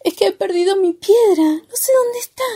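sobbing, high-pitched storybook character; Opu. A narrator's reading. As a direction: whimper it through acted sobs. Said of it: "entre sollozos".

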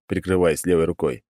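usual speaking voice

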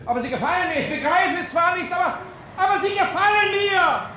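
high, loud call after someone leaving; shouting after him. Acted. Al shouts after the departing doctor, high and loud.

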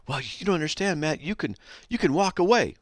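lightly voiced scoffing critic; mock objector. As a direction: parody the critic who says you can walk away. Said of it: "mocks an opponent's objection".

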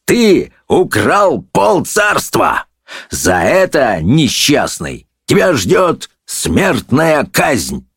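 slow, hammered accusation; raging verdict, high then weightier; furious judge. A narrator's reading. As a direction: pass sentence in wrath, stretching words. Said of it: "бешено рявкнул судья".

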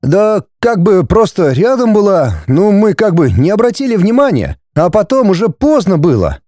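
high-pitched, sheepish excuse; guilty mumble. A narrator's reading. Son excusing himself to father, guilty. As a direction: fumble through it apologetically. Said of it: "промямлил виновато парень".

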